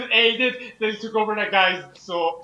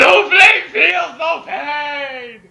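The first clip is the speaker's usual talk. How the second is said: loud, slow, high shouted boast; villain catchphrase; 7 semitones higher, 8 dB louder, much slower than usual. Acted. Quoting Snowflame to a friend, slow loud villain shout. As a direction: bellow it like a comic-book villain.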